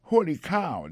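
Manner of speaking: loud, high amazed exclamation; loud high holy cow; surprised. Shown as a demonstration. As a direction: exclaim in astonishment. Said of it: "il y a un rapport avec le monde qui saisit".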